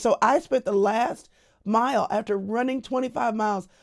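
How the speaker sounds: usual speaking voice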